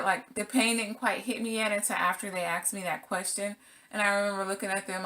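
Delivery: usual speaking voice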